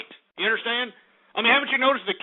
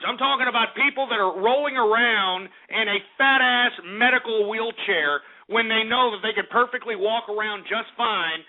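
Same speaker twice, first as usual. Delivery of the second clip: normal talking voice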